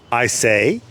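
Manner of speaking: ordinary delivery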